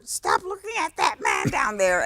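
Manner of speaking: high-pitched puppet character scolding; Grover. Retold or imitated. Puppeteer scolding a nervous new actress in character, high Muppet voice. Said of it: "finally said to me as Grover".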